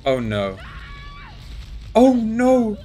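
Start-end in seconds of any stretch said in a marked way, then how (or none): 1.9-2.8 high, loud cry of dismay; alarmed cry. Real, not acted. Player sees the monster attack, alarmed cry.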